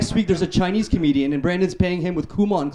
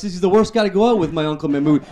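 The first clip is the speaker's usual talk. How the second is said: usual speaking voice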